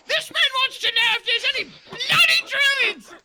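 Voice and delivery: very high and loud, cracking up; laughing doe shout. Acted. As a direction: yell at the buck through laughter.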